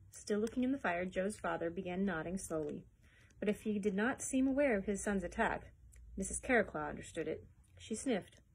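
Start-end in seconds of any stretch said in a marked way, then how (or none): none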